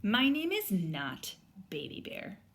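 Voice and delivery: put-on lively read-aloud voice for children; story read aloud. A narrator's reading. Teacher reading a picture book aloud to students, lively storytelling. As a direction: bring the story to life for the class.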